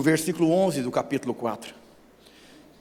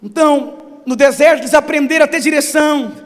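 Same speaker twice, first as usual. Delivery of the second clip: shouted preaching, high and loud; preaching at full force; 15 dB louder than usual. Acted. Pastor preaching to the church, shouting. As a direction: thunder it out over the congregation.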